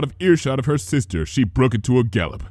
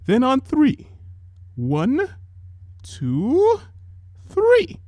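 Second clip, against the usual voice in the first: big sister counting down; much slower than usual. A narrator's reading. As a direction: count it up, last number lifting. Rarity counting.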